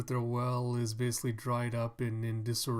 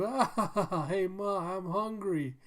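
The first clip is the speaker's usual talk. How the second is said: put-on higher, giggly child voice with a laugh; laughing little kid; 7 semitones higher, 4 dB louder than usual. Little kid tells his mom he's hungry, higher, giggling. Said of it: "a little kid that's laughing".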